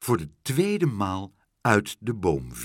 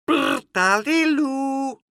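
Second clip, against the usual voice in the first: very high, slow, loud drawn-out bird call; loud bird cry; 6 dB louder, much slower than usual. Acted. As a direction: trill it out as a strange bird. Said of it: "riep heel hard".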